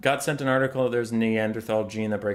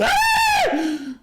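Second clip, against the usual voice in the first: loud, very high scream of frustration; frustrated scream; 6 dB louder than usual. Retold or imitated. Fed up with her job, high frustrated scream. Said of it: "That was an interesting outburst".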